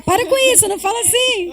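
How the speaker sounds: high, loud motherly scold. Retold or imitated. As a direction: snap at the kids to stop.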